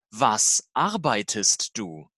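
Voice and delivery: ordinary talk, nothing marked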